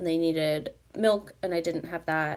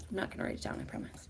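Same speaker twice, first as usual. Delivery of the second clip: whispering; 13 dB quieter, faster than usual. Real, not acted. Whispered aside promise.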